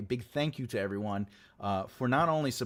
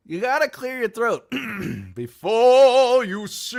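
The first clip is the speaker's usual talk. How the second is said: hammed-up punchline; 11 semitones higher, 11 dB louder, slower than usual. Repeats punchline for effect.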